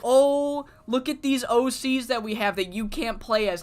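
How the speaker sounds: high, loud mocking quote; exaggerated loud showing-off; mock developer boast. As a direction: crow it like a teasing game company. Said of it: "they're like".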